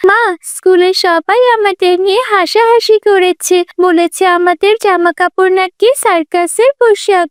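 put-on high, upset child's voice; hurt little daughter. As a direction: complain to mother about being mocked. (আহত ছোট মেয়ের উঁচু গলা)

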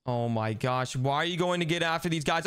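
ordinary delivery

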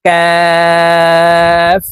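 কাফ with tongue a little forward of the root, long and drawn out; Arabic letter Kaf. Shown as a demonstration. Teacher showing viewers কাফ, long drawn letter. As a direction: stretch কাফ slowly. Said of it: "কাফ হরফ জিহ্বার গোড়া ... উচ্চারণ করব".

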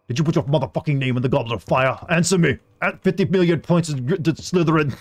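lightly voiced English Dumbledore, mock furious; English accent rage. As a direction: imitate a shouting British headmaster. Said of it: "softer English voice".

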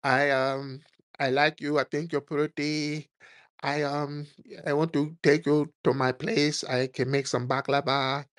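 mock pickup lines in an even monotone; hesitant parody of the caller flirting; imitating club dude. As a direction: play the awkward foreign suitor, haltingly. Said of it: "one of these dudes buy you a drink".

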